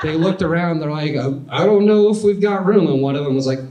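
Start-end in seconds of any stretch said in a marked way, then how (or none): 1.1-2.9 higher, unsure crew member; lightly voiced doubtful dancer, higher; hesitant dancer. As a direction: wonder aloud if there's space.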